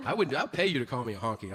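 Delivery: usual speaking voice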